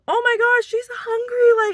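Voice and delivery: high, loud, slow burst of alarm; panicked mom. Retold or imitated. New mom alarmed that her baby is going hungry, high and loud. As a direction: relive the panic of a starving baby.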